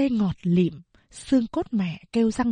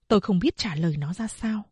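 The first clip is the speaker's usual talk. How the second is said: ordinary delivery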